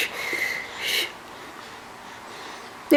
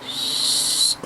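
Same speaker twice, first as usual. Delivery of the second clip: ordinary talk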